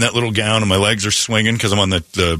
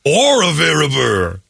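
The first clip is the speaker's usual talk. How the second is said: higher, slower voice picking out dishes; mock buffet diner; 5 semitones higher than usual. Host playing a diner choosing gross buffet mixes, higher voice. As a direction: list the food like a dazed diner at the buffet.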